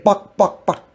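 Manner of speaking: a quick clucking chicken sound; chicken clucks. Acted. Player asks if AI was clucking like a chicken. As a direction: cluck it like a hen. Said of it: "Were you a chicken or something?".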